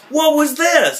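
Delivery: high-pitched, incredulous outburst; mock indignant accusation. Retelling a confrontation over spy photos, mock indignant. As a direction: voice leaps way up in retold indignation.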